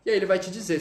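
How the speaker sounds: normal talking voice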